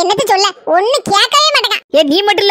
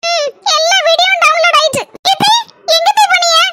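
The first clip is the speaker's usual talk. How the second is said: put-on higher young daughter-in-law voice; 8 semitones higher than usual. Daughter-in-law questioning her mother-in-law, higher young voice. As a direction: play the sweet young bride.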